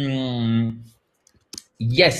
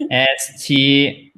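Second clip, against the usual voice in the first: spelled out slowly; 4 dB louder, much slower than usual. Shown as a demonstration. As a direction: spell "R E double S" very slowly, letter by letter. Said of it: "je précise".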